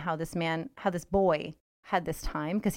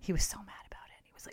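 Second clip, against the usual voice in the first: whisper; 8 dB quieter, faster than usual. Real, not acted. Gossip aside.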